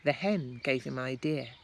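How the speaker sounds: plain reading voice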